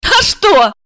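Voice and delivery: high, cocky little retort; high-pitched offhand challenge; smirking. A narrator's reading. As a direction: toss it back with a smirk. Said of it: "усмехнулась Марина".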